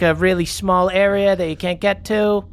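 put-on high, cocky teasing kid voice; mocking street kid. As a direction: taunt him like a smug kid.

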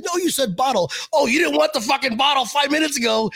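high-pitched hammed-up indignation; mock protest. Host retells bottle banter with a player, mock indignant.

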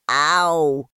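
loud yelp of pain; a short loud ow. A narrator's reading. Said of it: "he said".

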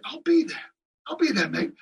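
put-on English accent. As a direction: play Mitch accepting in an English accent. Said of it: "with his English accent".

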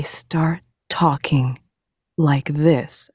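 hushed, drawn-out hospital staff talk; slow, gentle imitation. Patient mimicking neurology staff, slow and gentle. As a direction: imitate overly careful staff, very slowly. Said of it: "Why are you guys talking like that".

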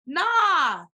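high, loud dismissive nah. Real, not acted. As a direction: brush it off loudly.

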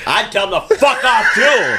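laughing shout. Real, not acted. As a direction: blurt it out through laughter. Laughing joke about cursing.

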